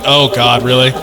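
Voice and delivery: ordinary delivery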